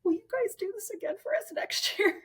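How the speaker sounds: very high mimicked group begging; squeal-high pitch, imitating an eager plea; imitated eager plea. Women at retreat's end begging her, imitated high plea. Retold group pleading, repeated.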